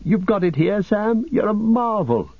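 very high, breathless amazement; overjoyed disbelief, high throughout; astonished delight. Acted. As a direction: marvel at it, pitch soaring. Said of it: "gasped Frodo".

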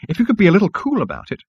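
high, quick, tetchy reproof; snapped. Acted. Insect receptionist asks Zaphod for restraint, snapping. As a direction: tick off the customer briskly. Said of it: "snapped the fragile little creature".

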